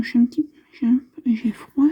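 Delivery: ordinary delivery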